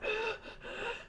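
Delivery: a thin, airless gasp for breath; imitated gasp. Showing how he gasped with a swelling throat. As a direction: mimic a choked attempt to breathe. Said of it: "it was like".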